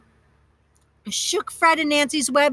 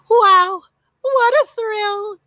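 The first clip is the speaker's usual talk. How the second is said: higher, louder delighted exclaim, slow on "what a thrill"; 8 semitones higher, 7 dB louder, much slower than usual. A narrator's reading. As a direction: savour the thrill of the ride. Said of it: "Aunt Hazel declared".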